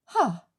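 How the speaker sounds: short, loud contemptuous laugh-bark; scornful ha. Acted. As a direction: scoff at the demand. Scornful retort to request.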